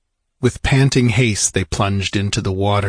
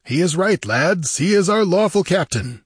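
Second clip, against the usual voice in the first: high, eager endorsement; rally the crew to please him; raised, eager corsair voice; ingratiating pirate; 10 semitones higher, 3 dB louder than usual. A narrator's reading. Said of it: "was eager to ingratiate himself".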